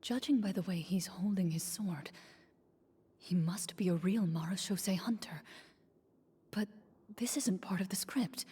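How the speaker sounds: unvoiced worried aside; whisper. Real, not acted. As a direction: whisper the doubt under your breath. Private aside during the scene.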